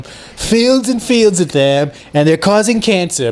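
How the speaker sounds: mocking Trump on wind turbines; Trump impression. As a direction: drag it out like Trump ranting.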